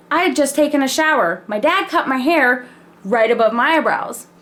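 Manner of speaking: normal talking voice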